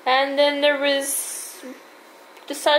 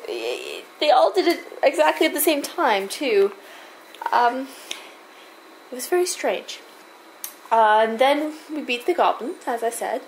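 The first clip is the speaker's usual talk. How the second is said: ordinary talk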